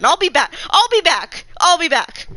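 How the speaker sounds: very loud, agitated outburst; upset outburst. Real, not acted. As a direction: blurt it out loudly in frustration. Streamer upset, abruptly announces redoing trial.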